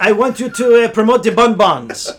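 playing a Luxembourg chocolate maker pitching a sponsorship, in a mock Luxembourgish accent. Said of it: "I don't know what accent that was".

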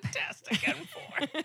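breathy giggling whisper of the phrase; laughing mock echo. Real, not acted. Cohost laughing at her silly phrase, repeating it. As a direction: wheeze it out through laughter.

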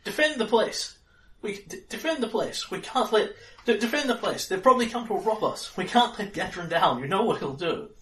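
snapping, high-pitched urgent orders; agitated, stammering command; Yagan. Acted. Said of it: "Yagan snaps".

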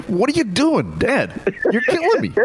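very high, whiny mock protest; imitated exasperated son. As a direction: wail at Dad's awful joke. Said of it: "he wanted to stab me".

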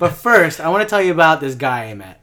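loud, lively friend impression; imitating co-host. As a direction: ham up your buddy's storytelling. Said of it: "you're doing Jin right now".

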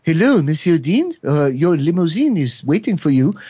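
imitated French accent on the phone message. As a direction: do a lilting French hotel operator. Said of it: "said to me with a French accent".

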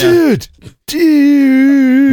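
high, loud, stretched-out "dude"; mock exec dude. Joker replaying the exec's catchphrase, high and drawn out. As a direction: wail it long and high like an exaggerated dude. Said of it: "That was a good reenactment".

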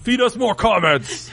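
high wrestler promo, laughing; booming wrestling catchphrase; Ryback voice. Retold or imitated. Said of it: "I was trying to do a Ryback voice".

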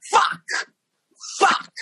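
high, explosive curse with a pause between; exasperated outburst. Retold or imitated. Singer puked on for the second time, exasperated cursing.

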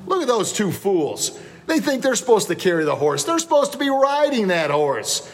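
high-pitched, incredulous heckling without much volume; mock yell. Townspeople jeer two fools carrying a horse, mock yelling. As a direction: imitate a jeering crowd, pitch climbing sharply. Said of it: "all the townspeople yell out".

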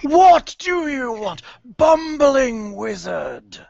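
slow, high, booming banshee; hateful ghost, drag every word; dark lord. Acted. Banshee demands of wizard.

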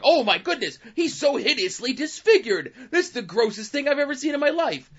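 high, hammed-up mock disgust; mock horror. Mocking Deadpool's onlookers, high hammed-up horror. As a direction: squeal it like fake horrified onlookers. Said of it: "oh, everyone's like".

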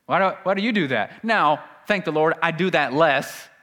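usual speaking voice

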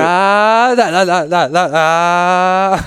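high revving engine noise, sung out; dirt bike impression. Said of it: "give me your best motorcycle impression".

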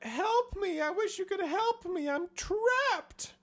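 high, panicked pleading voice; Jane pleading. Retold or imitated. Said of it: "And she's like".

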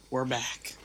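ordinary talk

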